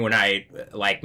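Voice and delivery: normal talking voice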